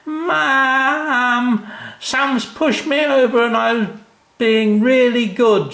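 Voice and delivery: put-on high, loud, slow whining little girl; fake whiny cry. A narrator's reading. Jenny shouting to mum, fake crying. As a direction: wail like a tattling little sister. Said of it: "shouted Jenny, pretending to cry".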